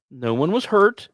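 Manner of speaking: ordinary talk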